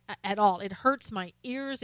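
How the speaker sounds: ordinary talk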